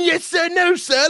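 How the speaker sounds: high, loud, scornful parroting; Ray Winston mocking. Actor mocking the student's bland reading, angrily imitated. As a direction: throw the meek reply back angrily. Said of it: "you're sitting there going".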